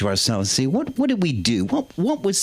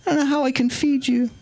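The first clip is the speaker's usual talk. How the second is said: the Queen's voice; 8 semitones higher than usual. Retold or imitated. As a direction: stay in the high Queen impression while reading the menu. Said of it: "the Queen's voice".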